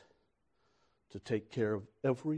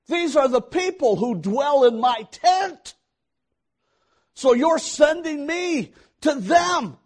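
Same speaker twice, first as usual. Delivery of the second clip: high, loud protest to God; impassioned preacher as Moses; 12 semitones higher, 14 dB louder than usual. Acted. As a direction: cry it out at full preaching force.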